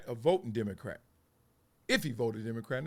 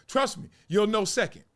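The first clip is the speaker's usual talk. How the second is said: ordinary delivery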